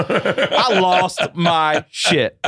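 high, slow, laughing delivery; amused, high and stretched out; laughing admission. Real, not acted. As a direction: draw it out through laughter. Laughing at his own story.

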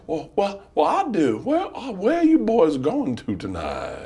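put-on drunk Southern man. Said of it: "my favorite Southern accent is drunk man".

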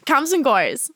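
imitating an Aussie twang on Comes and goes, slow; a clumsy try at Australian; mock Aussie accent, slowed down; bad Australian accent. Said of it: "That was awful".

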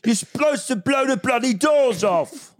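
high, drawn-out Michael Caine cry; hammed-up Cockney film-star outburst; Michael Caine impression. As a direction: do an over-the-top high-pitched Michael Caine. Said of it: "So it's just Michael Caine's impression".

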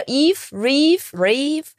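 "Eve" then "Reeve", each set apart with rising tone; name built up stepwise. Shown as a demonstration. Guest derives her name for host, spelling out "Eve, Reeve" stepwise. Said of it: "das R vom Ruffy genommen".

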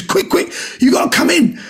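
high, hurried urging to come see; urgent excited call. Retold or imitated. Manager urging Omid into the party, excited and high. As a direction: hustle him in, breathless with news.

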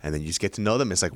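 ordinary talk

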